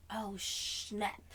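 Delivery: ordinary talk, nothing marked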